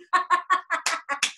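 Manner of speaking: high, loud ha-ha burst; gloating bear laugh. Acted. Bear rubbing it in, gloating laugh. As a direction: cackle in victory.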